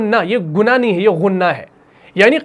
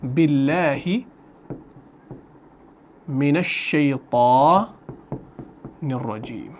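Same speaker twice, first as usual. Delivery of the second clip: slow, drawn-out Quranic recitation; measured tajweed chanting; 5 semitones lower, 6 dB quieter, much slower than usual. Shown as a demonstration. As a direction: recite it slowly, syllable by syllable. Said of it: "تو ہم یہاں پہ لکھیں گے".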